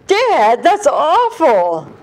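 high, loud, slow scold; mock appalled. Daughter scolding dad's joke, loud drawn-out scold. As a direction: groan it at him, appalled. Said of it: "I said".

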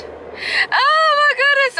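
high, slow half-crying oh my goodness; real pre-appointment jitters breaking into a whimper; nervous whimper. Real, not acted. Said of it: "like, nervous".

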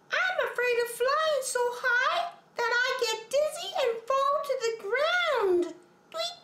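extremely high tweeting bird voice; chirping bird. Acted. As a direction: chirp like a tiny anxious bird. Bird character speaks.

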